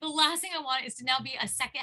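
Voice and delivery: high, loud mock protest; exasperated listener. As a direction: voice an overwhelmed client objecting, laughing incredulity. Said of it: "you're like".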